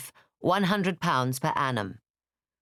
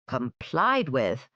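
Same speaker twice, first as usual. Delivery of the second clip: slow, sardonic repeat; dry incredulous echo; much slower than usual. Acted. Father mocking the idea of mere compliance, slow and dry. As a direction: drag out the words with scorn.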